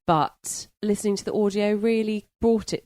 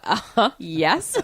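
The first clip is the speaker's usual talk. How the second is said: mock eager yes; 4 dB louder, slower than usual. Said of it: "I was like".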